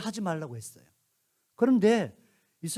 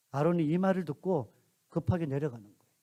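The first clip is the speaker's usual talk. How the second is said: ordinary talk, nothing marked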